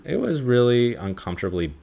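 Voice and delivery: normal talking voice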